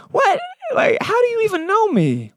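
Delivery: high, crying-out shock; stunned disbelief. Retold or imitated. Nathanael stunned that Jesus knows him, high shocked cry. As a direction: squeal it out in disbelief.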